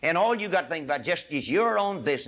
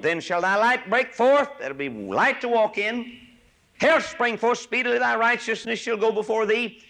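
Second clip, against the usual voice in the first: scripture reading voice; 9 semitones higher, 6 dB louder than usual. Acted. Preacher reading Isaiah to the congregation, ringing proclamation. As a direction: proclaim the verse with ringing weight.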